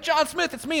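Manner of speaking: playful mock eagerness; eager answer. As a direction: jump up and claim it. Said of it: "And you get up".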